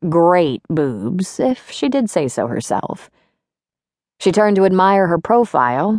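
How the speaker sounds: ordinary delivery, nothing marked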